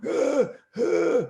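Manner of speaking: high, strained pretend moans; faked pained groans. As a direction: keep selling the injury. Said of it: "on the ground on all fours going".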